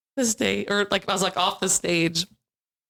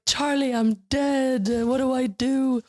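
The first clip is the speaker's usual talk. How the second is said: mock helpless wail; 7 semitones higher, slower than usual. Playing Linda in the scene.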